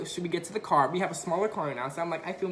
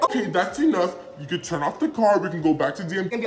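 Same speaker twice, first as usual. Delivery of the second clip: stern examiner; 5 dB louder than usual. Retold or imitated. Examiner stops the test.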